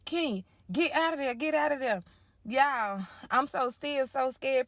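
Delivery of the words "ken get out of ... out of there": put-on a mother telling her child to get out; worried mom. Mom on the phone telling Ken to get out, higher and urgent. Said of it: "My mama called".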